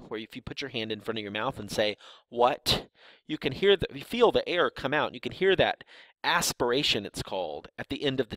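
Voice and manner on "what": "What?" with a puff of air released on the final t; aspirated t. Shown as a demonstration. Showing students the aspirated t in "What?". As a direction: let the air burst out at the end of "What?". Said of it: "where air comes out at the end".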